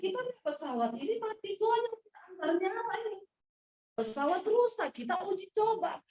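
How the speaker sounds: hammed-up passenger outrage; mock protest. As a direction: play the grumbling passengers refusing to fly. Passengers protesting plane, retold.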